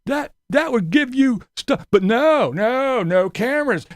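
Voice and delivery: high, slow ranting parody; mock angry Tater. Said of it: "That's how Tater was".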